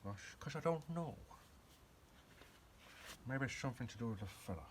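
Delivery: lightly voiced film-spy impression; a hint of slushy-S secret agent; James Bond. Puzzling over the filler's effect, lightly put-on spy voice. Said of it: "keep talking like James Bond".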